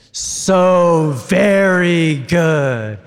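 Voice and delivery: very slow, loud, stretched words; drawn-out loud so very good. Real, not acted. Leading the crowd's drawn-out shout, loud and very slow. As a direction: stretch every word out loud.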